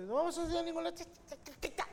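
put-on very high fast whiny teen voice; sulky teenager. Teen refusing to go out with parents, high whiny mock voice. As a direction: whine it like a bratty adolescent. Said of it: "acá el adolescente".

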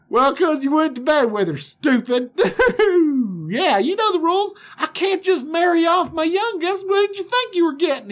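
high, loud country cackling taunt. Acted. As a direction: jeer at him in a squawky Southern voice. Voices Laban mocking the groom.

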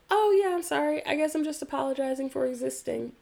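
high, light mock laugh-off. Retelling her offhand reply to the yoga partner, lightly laughing. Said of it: "And I just laughed".

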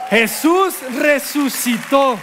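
Jesús resucitó proclaimed slow and triumphant; triumphant proclamation. Shown as a demonstration. Preacher announces the resurrection, triumphant.

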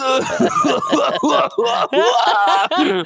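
high, loud fake bawling; hammed-up sobbing in bursts; mock wailing. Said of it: "That's him over there".

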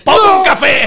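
high, loud desperate order; retold urgent yell; frantic shout. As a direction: bark the coffee order in panic. Ordering coffee to use bathroom.

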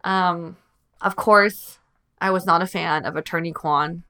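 ordinary talk

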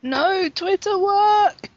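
long drawn-out high plea; exasperated slow whine at a frozen feed; pleading with Twitter. Real, not acted. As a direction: beg the app to work, stretching every word. Begging Twitter to load.

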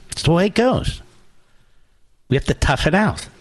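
high dismissive mock voice; mocking callous voice. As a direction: shrug off suffering in a sneer. Mocking callous official attitude.

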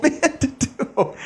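laughing through the words. Real, not acted. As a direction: breaks into a laugh.

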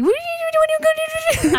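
high cartoon doggy voice. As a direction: squeak it like the pet. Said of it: "like a character that we're bringing around".

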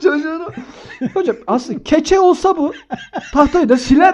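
words broken up by laughter; laughing through words. Real, not acted. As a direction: keep laughing as you speak. Laughing while retelling.

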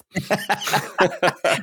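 high, strange warbling gibberish; garbled electronic whine; broken microwave noise. Pretend. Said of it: "That sounds like a broken microwave".

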